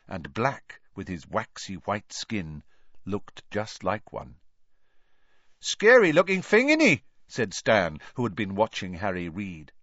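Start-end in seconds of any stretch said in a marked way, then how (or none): 5.6-7.0 high, loud cockney lad, mocking; Stan's voice. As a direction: tease him like a cheeky bus conductor. Stan to Harry.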